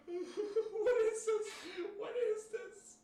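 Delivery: very high, slow, squeaky with laughter; drawn out through helpless giggles; laughing disbelief. Real, not acted. Laughing disbelief at game text.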